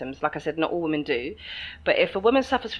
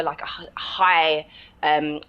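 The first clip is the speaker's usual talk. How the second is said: normal talking voice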